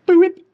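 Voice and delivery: high, loud little "boop"; playful boop. Real, not acted. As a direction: pop out a high-pitched boop. Playful sound as part goes in.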